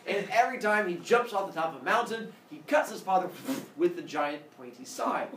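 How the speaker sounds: normal talking voice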